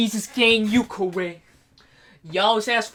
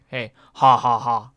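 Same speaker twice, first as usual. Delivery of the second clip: usual speaking voice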